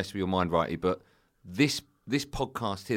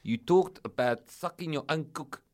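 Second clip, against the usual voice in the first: slightly raised, exaggerated South African voice; South African accent; 4 semitones higher than usual. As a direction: play the South African agent reporting a complaint. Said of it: "just speak normally".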